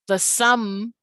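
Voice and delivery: "sum" held long, rising; stretched stressed syllable. Shown as a demonstration. Teacher showing the stressed syllable of summer, drawn-out "sum". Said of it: "stretches out".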